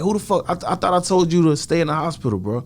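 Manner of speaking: retold angry disbelief at a friend; exasperated scolding. Retelling scolding friend who left hospital.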